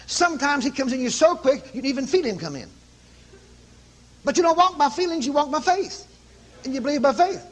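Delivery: rising, fervent preacher's exhortation; a touch of pulpit fire; preaching. Acted.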